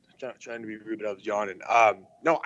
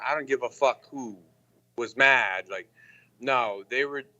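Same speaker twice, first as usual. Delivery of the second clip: normal talking voice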